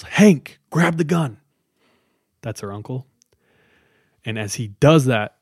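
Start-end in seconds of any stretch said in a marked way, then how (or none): 0.0-1.4 loud, high urgent call; imitated yell. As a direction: yell an order like the alarmed dad in the story. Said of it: "and yells".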